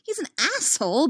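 high, agitated protest; squeaky, flustered insistence; verging on shrill. A narrator's reading. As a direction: pitch it right up, indignant. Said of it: "verging on shrill".

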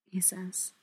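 usual speaking voice

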